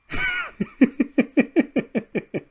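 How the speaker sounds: long, very high wheezy giggle; giggling fit. Real, not acted. Host after telling the absurd scene, long high giggle. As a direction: crack up helplessly at your own bit. Cracks up at own story.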